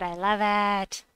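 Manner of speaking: slow, delighted and loud. Real, not acted. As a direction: savor the win out loud. Delighted at game combo.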